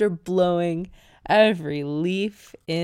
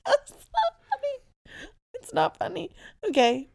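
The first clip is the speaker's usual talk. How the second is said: high, tearful voice fighting laughter; helpless laughing; 12 semitones higher than usual. Real, not acted. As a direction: try to stop laughing and fail. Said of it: "I don't know why I'm laughing".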